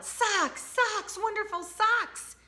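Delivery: very high joyful cry; excited yell. Acted. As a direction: shout it with delight. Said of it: "Tina ran home and yelled".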